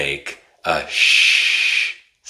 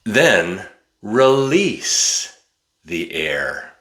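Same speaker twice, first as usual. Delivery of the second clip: slow demo; 2 semitones higher, slower than usual. Shown as a demonstration. Teacher showing how to release the air, slowly. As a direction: stretch the words as you show it. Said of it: "It's like an explosion".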